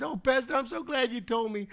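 high, put-on weepy voice; mock tearful. Kyle tearfully thanks her pastor, mock crying voice. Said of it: "She starts crying".

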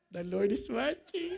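low, soft teasing reminder; gentle reminder. Retold or imitated. Husband reminding his irritated wife, low gentle reminder. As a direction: remind them quietly, voice dropped low. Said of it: "I would just remind her".

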